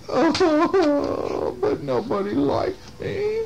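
high, hammed-up boo-hoo; mock crying. Lonely Sammy on his birthday, mock crying. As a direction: wail it high like a storybook character. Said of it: "he's still crying".

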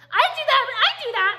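high whiny mock voice, well above her own; mocking offended people. Mocking offended followers in a high whine. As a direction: sneer it like an offended commenter. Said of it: "People get so offended. Like".

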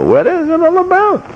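high-pitched, baffled protest; exasperated bewilderment. Acted. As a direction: voice shooting up high in disbelief. Acting sufferer's bewildered questioning.

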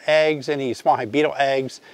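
ordinary talk

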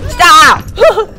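sudden high loud shout; startled shout at a surprise attack. Real, not acted. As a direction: yell as it scares you.

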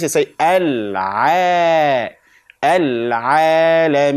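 slow, wide-open mouth on al-a, al-alamin. Shown as a demonstration. Teacher demonstrating al-alamin slowly, mouth open. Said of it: "open your mouth like this".

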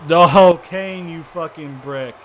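loud sudden outburst, then slow; angry outburst. Real, not acted. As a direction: blurt it out in frustration. Outburst at Kane.

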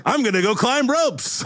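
high-pitched excited cry; acted burst of glee; gleeful declaration. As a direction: announce it high, like a delighted kid. Captain inspired, runs off to ropes.

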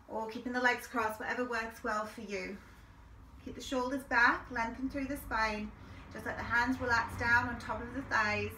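calm, steady breathing cues; guided meditation. Acted.

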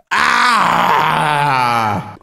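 a loud, high mock-furious yell; over-the-top angry shout; furious noise. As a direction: let out a hammed-up rage shout. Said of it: "That's my furious noise".

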